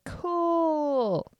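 high, drawn-out mock-delighted "cool"; sarcastic enthusiasm. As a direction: stretch it out high and let it slide down. Sarcastic reaction to car trouble.

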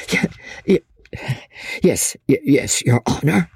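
halting, broken, stuttering assent; cowed old man's stutter; frightened stammer. A narrator's reading. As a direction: give in nervously, catching on each word. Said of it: "stammered the poor wretch".

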